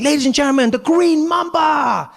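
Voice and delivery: high, shouted show announcement with a stretched finish; Deng Basan. Acted. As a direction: introduce the act like a hyped emcee. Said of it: "Deng Basan is like".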